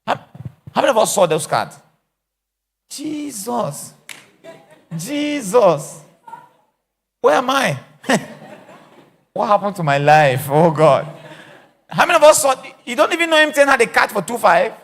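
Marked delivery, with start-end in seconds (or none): 2.9-6.0 high exclaimed Jesus, rising loud; mock shock. Mock shock at own age. 7.2-14.7 loud high mock wailing; hammed-up dismay. Hammed-up despair at being old.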